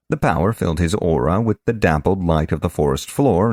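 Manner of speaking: plain reading voice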